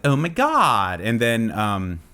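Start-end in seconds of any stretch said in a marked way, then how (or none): 0.0-1.0 put-on higher-pitched Valley Girl exclamation. Host quoting the eighties movie, high Valley Girl. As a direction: draw out "God" like a Valley Girl. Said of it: "called Valley Girl. And it was like".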